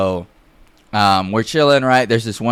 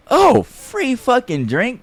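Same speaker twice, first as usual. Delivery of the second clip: high, drawn-out excited question; drunken delight; 13 semitones higher, slower than usual. Retold or imitated. Drunk buddy seeing the free bottle, delighted. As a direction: react thrilled to free booze, slowly.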